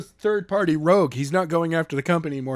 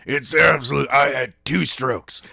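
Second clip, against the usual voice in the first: lower, strained put-on wreck voice; Daniels ruined voice; 3 semitones lower than usual. Daniels ranting about Skylar, low and strained. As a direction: croak it like a stroke-addled cop. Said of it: "He's like ... becoming like this".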